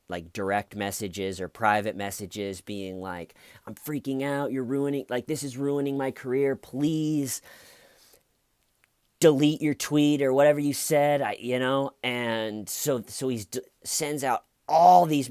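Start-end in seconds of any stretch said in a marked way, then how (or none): 3.7-11.2 higher-pitched imitation of a panicked plea; retold begging. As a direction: act out someone freaking out and pleading. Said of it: "begging for them to delete it".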